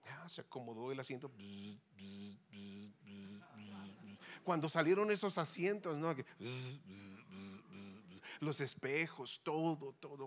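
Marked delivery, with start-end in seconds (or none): 1.3-4.2 low, soft mechanical buzzing; short repeated low buzzes like an electric motor; imitated seat motor. Preacher imitates the car seat adjusting, soft motor buzz. 6.2-8.2 quiet, low repeated buzz; imitated seat motor. Preacher mimics the automatic seats moving, soft low buzzing.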